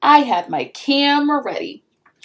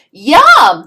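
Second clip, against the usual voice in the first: loud delighted yum; 3 semitones higher, 13 dB louder than usual. A narrator's reading. Little brother tasting candy, delighted. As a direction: savour it loudly.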